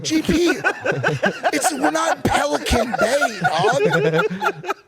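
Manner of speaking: high and laughing through the words; laughing retort. Real, not acted. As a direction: crack up while telling him off. Laughing while retorting to dad.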